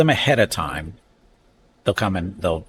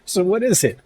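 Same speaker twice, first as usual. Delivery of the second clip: high-pitched mock bafflement; retold puzzled mom; 11 semitones higher than usual. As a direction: imitate a mother who doesn't get it, voice raised high.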